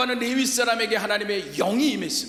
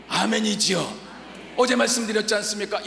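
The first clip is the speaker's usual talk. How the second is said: ordinary talk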